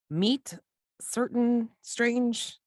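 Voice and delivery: drawn out and insinuating, pitched high; slow, knowing tease; suggestive. Real, not acted. GM hinting at shady establishments.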